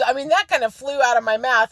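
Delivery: usual speaking voice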